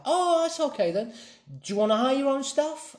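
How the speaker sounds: put-on very high London girl voice; London girl's accent. Girl reassures a new customer, very high London accent. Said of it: "I'm not gonna do the accent".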